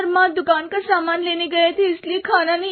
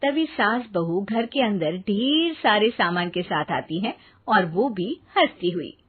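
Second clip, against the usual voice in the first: lower, even storyteller voice; story narration; 7 semitones lower, 4 dB quieter than usual. A narrator's reading. Narrator telling the audience what happens, in a calm storytelling voice. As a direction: narrate the scene calmly.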